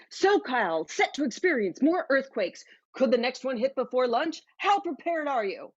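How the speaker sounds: dramatic newscast headline delivery; punchy, urgent TV news read; news anchor. Acted. News outlet breaking the top earthquake story, mock anchor voice. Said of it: "the top story on every out".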